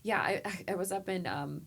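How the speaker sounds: normal talking voice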